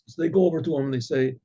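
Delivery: usual speaking voice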